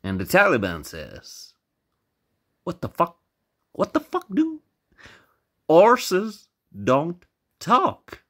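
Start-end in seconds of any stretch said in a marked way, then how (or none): 2.6-8.2 incredulous outburst. Acted. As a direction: play baffled indignation, pitched high. Taliban reacts, voiced in joke.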